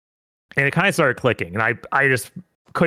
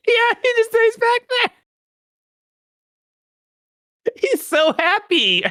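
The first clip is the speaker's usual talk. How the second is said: squeaky cooing voice. Real, not acted. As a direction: coo at it like a pet. Cooing over the shark.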